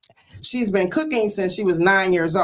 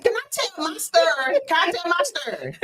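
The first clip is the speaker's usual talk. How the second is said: playful protest; 12 semitones higher than usual. Real, not acted. Host protests guest interrupting story.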